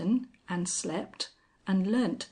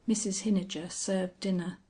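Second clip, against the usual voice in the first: plain reading voice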